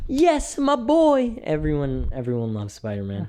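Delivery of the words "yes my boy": high, loud cheer of delight; excited cheer. Retold or imitated. Fan cheering that Spider-Man lives, thrilled shout.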